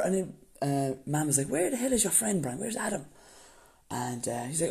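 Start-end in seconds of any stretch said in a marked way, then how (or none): 1.5-3.1 high, exasperated mother; impatient mum. Retold or imitated. Mum demanding where the guest is, high exasperated voice.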